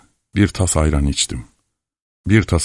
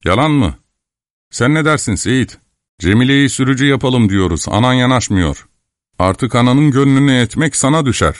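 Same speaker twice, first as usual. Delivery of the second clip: higher-pitched, coaxing character voice; persuasive team leader; 7 semitones higher than usual. Acted. Team leader appealing to the boy, lightly voiced persuasion. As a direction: win the boy over, pitched up.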